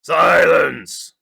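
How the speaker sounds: loud, sharp bark of a command; king barking Mac down. Acted. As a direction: cut the turtle off with one shout. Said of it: "barked back".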